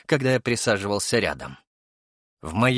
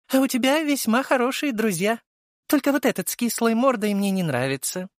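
high female voice, teasing; high-pitched, playful appraisal; haughty young woman character; 13 semitones higher than usual. A narrator's reading. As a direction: judge them lightly, pitched high. Venera comments on his friends.

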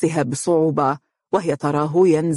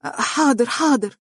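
higher, hurried flustered reply; 8 semitones higher than usual. A narrator's reading. Mohra flustered by her stepmother, higher nervous reply. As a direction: answer nervously, all in a fluster. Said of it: "قالت مهرة بارتباك".

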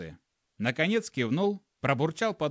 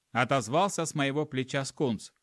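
ordinary delivery, nothing marked

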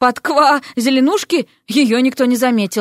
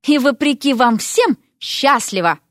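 slow, spiteful promise; vindictive; 3 semitones higher than usual. A narrator's reading. Vasya answers her unwanted groom, vindictive. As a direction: relish each word out of spite. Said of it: "мстительно ответила Вася".

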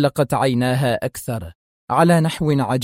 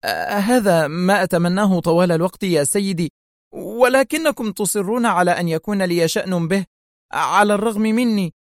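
higher, pleading, near breakdown; collapsing; 6 semitones higher than usual. A narrator's reading. Principal pleads with Zelman, falling apart. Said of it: "غمغمت في انهيار".

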